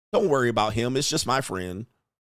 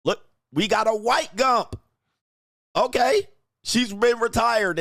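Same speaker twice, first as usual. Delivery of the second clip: excited shout; 9 semitones higher than usual. Real, not acted. Spotting the rich husband in her photo, shouting gleefully. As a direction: yell it out like you just struck gold.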